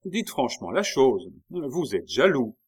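accusation ironique, plus forte et plus aiguë. A narrator's reading. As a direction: piquer l'interlocuteur avec ironie. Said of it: "la reine avec une ironie". (avec ironie)

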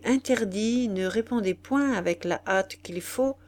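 usual speaking voice

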